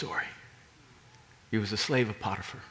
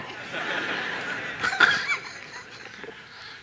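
high laugh. Real, not acted. Laughs at own joke.

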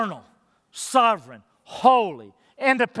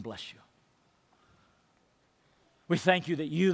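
soft, low reverent prayer; 8 semitones lower, 6 dB quieter than usual. Acted. Pastor praying over communion for the church, hushed and reverent. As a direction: pray quietly over the bread.

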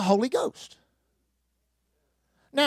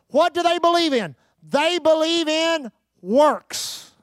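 raised, ringing preacher's cadence, hammering each line; preaching at full force; 4 semitones higher than usual. Acted. Pastor preaching hard to his church, at full force. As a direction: drive it home to the congregation.

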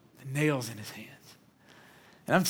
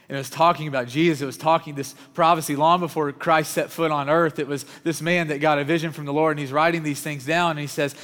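louder, driving sermon delivery; full-force preaching; 3 semitones higher, 5 dB louder than usual. Acted. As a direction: preach it hard to the congregation. Preaches with rising intensity.

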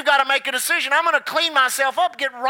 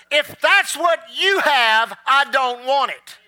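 slow, weighty retold words of the woman; slow and deliberate; 3 dB louder, slower than usual. Woman telling the preacher, slow and firm. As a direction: let each word land slowly. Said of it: "She looked at me and said this".

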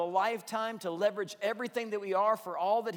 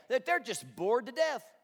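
ordinary talk

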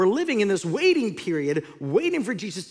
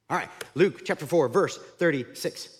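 normal talking voice

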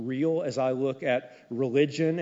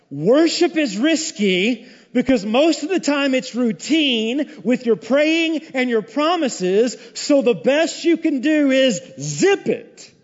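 loud, higher, slow pulpit emphasis; preaching at full force; 9 semitones higher, 7 dB louder, slower than usual. Acted. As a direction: press each word on the crowd. Preacher presses the point.

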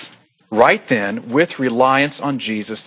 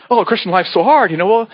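mocking complainer; 6 semitones higher than usual. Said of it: "I don't wanna make fun".

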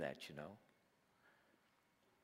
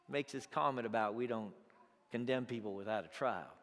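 ordinary talk, nothing marked

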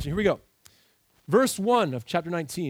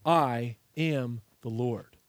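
slow emphatic refrain; 5 semitones lower, much slower than usual. Shown as a demonstration. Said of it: "But that's not the end of the verse".

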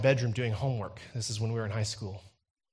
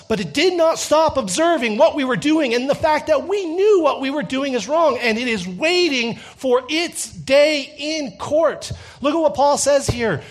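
high, loud, impassioned sermon; full-force preaching; 10 semitones higher, 8 dB louder than usual. Real, not acted. Preacher warns congregation that conscience will testify in court, forceful preaching. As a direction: drive each phrase home to the congregation, loud and high.

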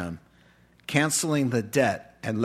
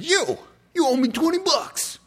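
much higher, shouted threat; mock angry demand. Servant throttling the man who owes him, angry and high. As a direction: snarl the demand. Servant grabbing debtor by throat.

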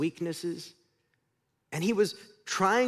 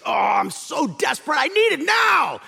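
high, loud, desperate plea; shouted craving; 8 semitones higher, 6 dB louder than usual. Acted. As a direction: beg as if you can't wait a second longer. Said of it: "the Hebrew word has to do with".

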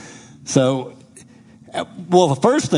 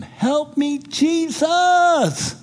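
much higher, pleading cry to Jesus; desperate inner plea; much slower than usual. Retold or imitated. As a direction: cry out from inside for rescue. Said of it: "deep within, I'm saying".

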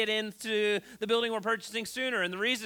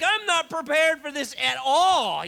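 panicked; 5 semitones higher, 6 dB louder, slower than usual. Retold or imitated. Panic retold on the ski.

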